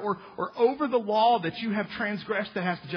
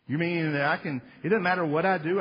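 ordinary delivery

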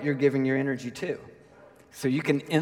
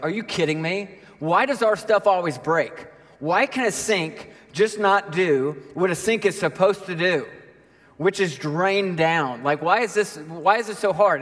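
higher-pitched exasperated complaint; worked-up, incredulous griping; mock exasperation; 3 semitones higher than usual. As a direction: gripe as if personally wronged by a sink. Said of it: "and I'm angry".

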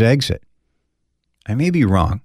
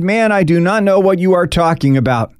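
high, louder, forceful protest; angry denial; 8 semitones higher, 4 dB louder than usual. Acted. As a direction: bite back at the accuser. Peter's final angry denial.

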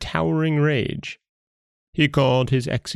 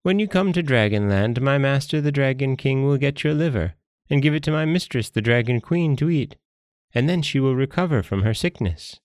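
higher-pitched, blithely explaining jellyfish; guileless jellyfish; 6 semitones higher than usual. Acted. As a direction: blurt out the plan in a naive creature voice.

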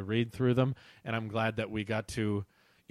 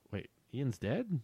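puzzled surprise; 7 dB quieter than usual. Acted. Reader reacts to sudden death.

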